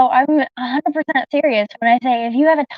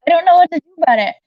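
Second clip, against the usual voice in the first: talking fast; 6 semitones higher, 5 dB louder, faster than usual. Real, not acted. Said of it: "I talk fast sometimes".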